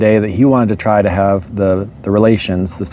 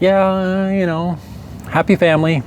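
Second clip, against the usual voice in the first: higher, softer, hollow agreement; half-hearted husband; 7 semitones higher, 4 dB quieter than usual. Retold or imitated. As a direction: mumble along without meaning it. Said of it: "the hubby's like".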